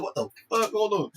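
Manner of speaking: high-pitched startled double take; high, baffled reaction; acted disbelief. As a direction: halt everything in mock shock. Acting own startled reaction to film.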